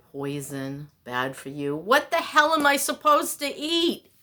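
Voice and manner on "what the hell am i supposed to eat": loud, high, fed-up demand; exasperated outburst. Real, not acted. Fed up with diet rules, loud frustrated demand. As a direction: let the frustration burst out. Ranting about diet advice.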